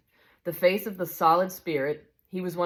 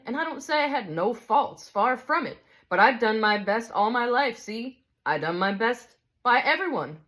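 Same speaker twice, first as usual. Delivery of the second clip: higher, self-righteous working-man's boast; boastful big ghost; 6 semitones higher than usual. Acted. Big ghost tells the spirit what sort of chap he was, self-righteous. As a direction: brag about your honest life.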